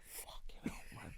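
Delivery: под нос, шёпотом; whispered curse. Retold or imitated. Shocked inner reaction, said under breath.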